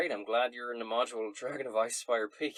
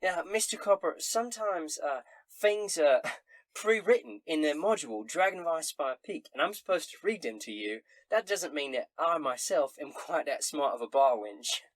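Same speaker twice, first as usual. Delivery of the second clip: high, loud tavern barmaid; 6 semitones higher, 6 dB louder than usual. Acted. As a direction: answer like a cheery bar wench. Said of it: "She says".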